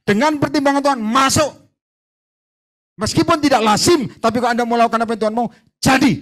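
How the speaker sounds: high, loud, fast preaching; fiery preaching. Real, not acted. Preacher warning his congregation at full force, loud. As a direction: drive the warning home.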